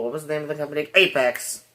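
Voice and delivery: ordinary talk, nothing marked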